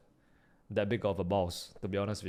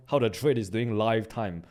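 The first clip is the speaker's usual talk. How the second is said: usual speaking voice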